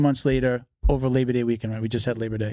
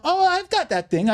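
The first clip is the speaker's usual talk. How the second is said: much higher, slower, sing-song offer; eager seller; slower than usual. Retold or imitated. Someone offering what you asked for, high slow eager voice. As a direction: pitch up high like a keen seller.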